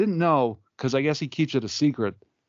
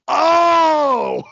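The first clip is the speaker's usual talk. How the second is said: long, loud, high dawning "oh"; acted realization; 9 dB louder than usual. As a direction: shout it as it suddenly clicks. Acts out his sudden realization.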